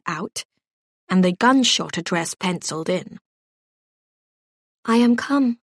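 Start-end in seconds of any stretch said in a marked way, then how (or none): 4.8-5.6 shaky voice. A narrator's reading. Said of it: "she said in a shaky voice".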